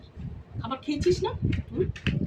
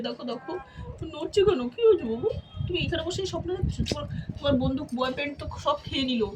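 cooing pet talk; 4 semitones higher than usual. Real, not acted. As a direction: murmur fondly to the sleeping cat, pitched up. Cooing to sleeping cat.

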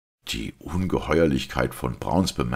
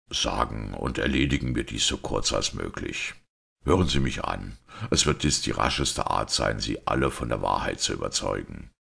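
lower-pitched, restrained priest voice; composed priest; 5 semitones lower than usual. Acted. Priest asking everyone to hear him out, composed. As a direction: play a priest calmly setting out the truth. Priest begins his explanation.

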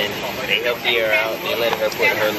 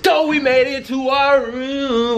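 excited; slower than usual. Acted. Mock announcer room tour opener.

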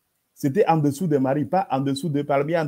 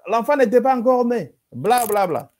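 high, raised mock contradiction; mocking objector; 9 semitones higher, 4 dB louder than usual. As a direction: mimic an opponent's denial, pitched way up. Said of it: "L'autre dit".

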